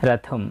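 "রথম" closed on a short m, no vowel after; half-m ending. Shown as a demonstration. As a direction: end "রথম" low on a half m. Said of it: "অর্ধেক ময়ের মতো উচ্চারণ করি".

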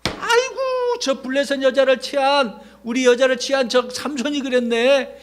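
high wailing "아이고"; lamenting villager. Retold or imitated. Villager answering the Philistines, high wailing lament. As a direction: wail then accuse. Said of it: "누가 그랬어?".

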